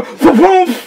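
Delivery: high, loud falling whoop; imitated whoosh. Boss crashing back down, imitated falling whoop. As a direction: voice a swooping drop from above.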